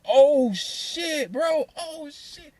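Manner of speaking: high, stunned oh shit; high startled oh shit, half laughing; shocked oh shit. Retold or imitated. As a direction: gasp it out in disbelief. Shocked at the bent door.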